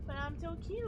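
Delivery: high cute begging; cute pleading voice. Acted. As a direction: plead sweetly to be spared. Begging not to be banned.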